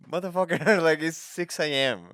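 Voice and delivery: loud, high comic outrage; outraged complaint. Retold or imitated. Owner to the cat jumping on the bed, outraged. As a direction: yell it like you've just been woken.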